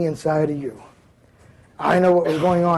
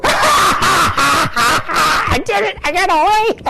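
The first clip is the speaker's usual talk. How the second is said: much higher, laughing cry of triumph; gleeful triumph; 10 semitones higher, 3 dB louder, much slower than usual. Retold or imitated. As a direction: crow with glee at getting away. Shoplifter's triumphant inner cry.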